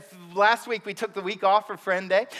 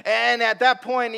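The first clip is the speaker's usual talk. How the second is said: usual speaking voice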